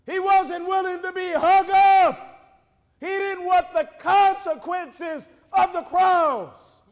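high, loud shouted sermon; full-force preaching. Acted. Preacher retells Peter denying Jesus, full-force preaching. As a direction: build into a shouted denial and keep it up.

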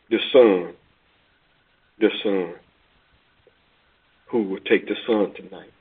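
preacher turning the auctioneer's call to the congregation, hushed; soft preaching echo. Acted. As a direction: let the call sink in quietly. Preacher echoes the call softly.

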